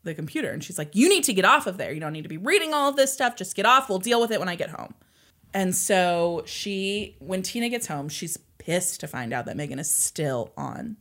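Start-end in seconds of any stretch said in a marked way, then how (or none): 0.9-4.9 furious mom. Retold or imitated. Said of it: "Tina is furious".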